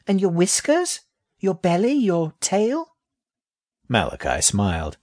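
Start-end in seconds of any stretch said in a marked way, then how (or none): 0.1-2.9 put-on high, childlike questions; a small rat, puzzled and curious; little rat voice. Young rat questions snake.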